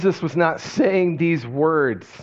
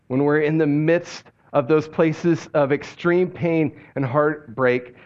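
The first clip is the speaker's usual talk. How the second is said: normal talking voice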